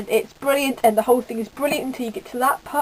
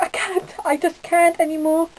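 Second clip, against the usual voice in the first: higher mock-tearful wail, hammed up; mock sobbing; 6 semitones higher than usual. [name] tells her brother why she is crying: higher mock sob. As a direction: play yourself too upset to talk. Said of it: "I was like".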